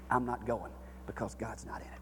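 hushed, breathy whisper; a timid whispered excuse; whispered prayer. Acted. Quoting a fearful private prayer.

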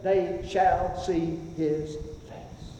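very slow and soft; slow hushed scripture; reverent awe. Acted. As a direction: linger in quiet awe. Awestruck scripture quote.

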